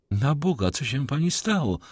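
worried call at moderate volume; alarmed exclamation. A narrator's reading. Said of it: "zawołał Debray".

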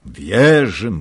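loud shout of agreement; all the officers shouting back; called out. A narrator's reading. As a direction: call it out together. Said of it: "wołali wszyscy".

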